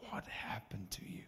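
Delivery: hushed whispered question. Retold or imitated. Friend at the bar whispering through tears. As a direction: whisper it, stunned. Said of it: "And he's like".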